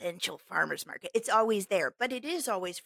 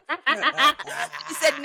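giggling as she tells it; laughing; 6 semitones higher, 6 dB louder than usual. Real, not acted. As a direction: laugh through the words. Laughing while retelling.

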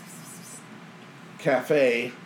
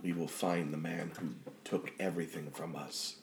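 vengeful inner presence; 5 semitones lower, 12 dB quieter than usual. Acted. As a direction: sink to a near-whisper, deliberate and ominous.

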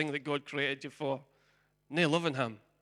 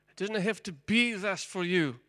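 building louder preaching appeal; rising appeal; 5 semitones higher, 7 dB louder than usual. Acted. Preacher inviting the congregation to hear the gospel, building. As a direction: lift the invitation to a climax.

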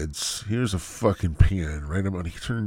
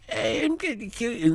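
put-on high squeaky kid voice; six-year-old gamer. Mocking the six-year-old Fortnite player, high and squeaky. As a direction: taunt like a tiny gamer brat. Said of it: "he's like".

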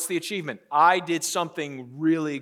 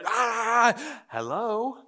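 higher, switched-on polite phone greeting; sudden sweet phone voice; 5 semitones higher, much slower than usual. Retold or imitated. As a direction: snap from yelling to a nice hello. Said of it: "we have this superpower to".

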